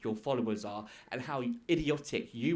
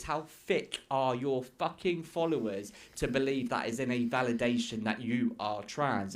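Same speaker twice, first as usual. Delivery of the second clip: ordinary delivery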